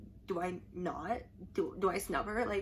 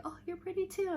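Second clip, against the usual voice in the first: cute idol reply; 10 semitones higher than usual. Retold or imitated. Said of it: "And she's like".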